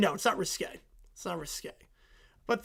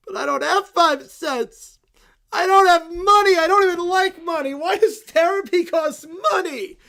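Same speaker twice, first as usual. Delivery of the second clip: loud, higher, near-crying whine; upset kid wail; 7 semitones higher, 9 dB louder than usual. Acted. Kid cries to Lucy about having no money, loud wail. Kid distraught over price.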